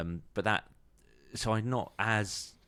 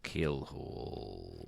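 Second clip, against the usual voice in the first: growled pirate title; 7 semitones lower, 6 dB quieter, much slower than usual. Pretend. Repeating song title dramatically.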